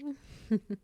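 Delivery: short, high, airy giggle; light giggle. Acted. Caretaker bandaging her patient's thigh, light teasing giggle.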